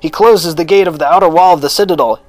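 high, loud, breaking news as it happens; urgent commentary building to a cry; excited eyewitness report. A narrator's reading. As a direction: call out each event with mounting alarm.

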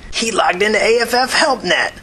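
high-pitched amused jeer, no real laugh. A narrator's reading. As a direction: call it out high, mocking the culprit. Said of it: "another cracker laughed".

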